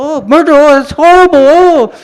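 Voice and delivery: hammed-up Mexican accent horror; mock horrified tourist. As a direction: gasp it out like a shocked tourist. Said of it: "acted like I was a tourist from Mexico".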